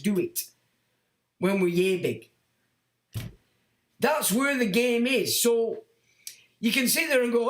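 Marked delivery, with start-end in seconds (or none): none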